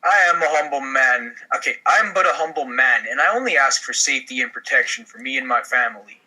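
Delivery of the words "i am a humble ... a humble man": ordinary talk, nothing marked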